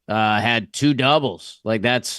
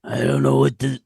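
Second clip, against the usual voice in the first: failed Pacino impression. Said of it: "no, that wasn't Pacino".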